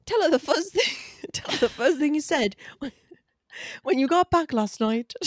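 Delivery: high-pitched bad English accent, nearly Gaelic; failed English accent. Shown as a demonstration. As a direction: botch an English accent, stumbling and giggling. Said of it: "No, mine is".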